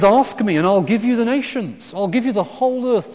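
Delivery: loud, high, eager offer; grand promise. Acted. God offering his son the nations, grand excited promise. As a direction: proclaim a lavish gift, voice lifted high.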